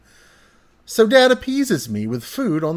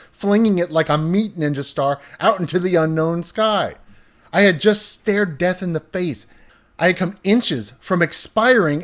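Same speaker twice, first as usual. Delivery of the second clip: ordinary talk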